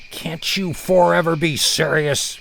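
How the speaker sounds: fed-up father begging for seriousness; dad pained at his giggling kids; pained exasperation. Retold or imitated. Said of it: "he uttered as he stared upward in pain".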